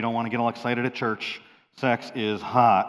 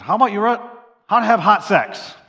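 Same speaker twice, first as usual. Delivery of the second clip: high, loud cheeky suggestion; Pastor Dave teasing; 10 semitones higher, 12 dB louder than usual. Retold or imitated. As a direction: pitch the racy title high and loud like a grinning friend. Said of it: "He said".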